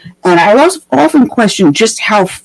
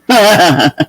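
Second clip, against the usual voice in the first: short real laugh; brief burst of laughter. Real, not acted. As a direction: laugh it off after the question. Host laughs after rhetorical question.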